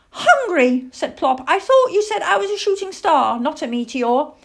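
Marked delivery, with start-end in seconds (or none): 0.1-0.9 high, loud little owl voice; blunt child-owl answer; hungry owlet. Acted. Plop answers how he is. 1.5-4.3 high, loud little owl voice; young owl querying a grown-up; puzzled owlet. Acted. Plop queries the man.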